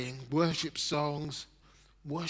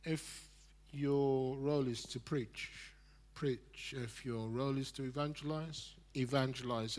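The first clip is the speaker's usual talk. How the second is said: quiet exhortation; 2 semitones lower, 8 dB quieter than usual. Real, not acted.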